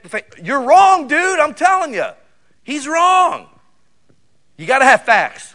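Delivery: loud, high, driving sermon delivery; fiery preaching. Acted. Preacher shouting at the congregation about faith and facts, fiery.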